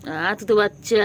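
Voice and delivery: slow, drawn-out pet coo. Real, not acted. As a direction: stretch each word out gently. Cooing slower to the cat.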